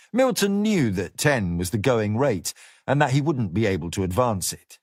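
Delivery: usual speaking voice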